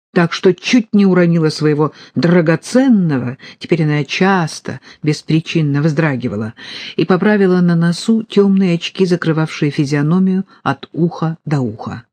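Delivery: plain reading voice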